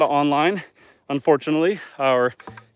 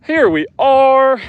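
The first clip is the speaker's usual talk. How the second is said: arrival announcement; 11 semitones higher, 10 dB louder, much slower than usual. Real, not acted. Arriving at the fishing spot.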